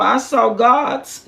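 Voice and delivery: "gods" drawn out slowly with a rise; stress on gods. Shown as a demonstration. Preacher stresses the word gods to the church. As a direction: linger on "gods". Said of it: "G O D S".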